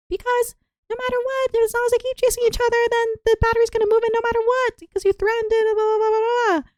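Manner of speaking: put-on high, fast kid voice answering; imitating a kid. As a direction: rattle it off like an eager child. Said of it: "Jimmy Bob".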